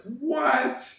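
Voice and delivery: squeaky high shocked what; a stunned high-pitched what. Shown as a demonstration. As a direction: jump way up in shock. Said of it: "just, like".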